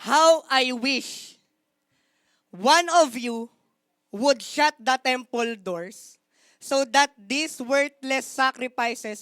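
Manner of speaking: slow, loud, solemn rebuke; weighty rebuke. Acted. The Lord rebukes the priests, slow, loud and solemn. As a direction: pronounce judgement slowly and heavily. Preacher voicing the Lord's rebuke.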